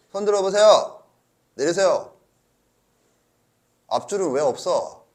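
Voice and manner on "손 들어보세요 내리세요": louder, higher call to the class. Real, not acted. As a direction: call out for raised hands. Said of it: "calling a show of hands".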